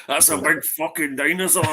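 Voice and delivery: slow, hammed-up Scottish movie line. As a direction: exaggerate the Scottish accent for a laugh. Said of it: "proper stereotypical Scottish line".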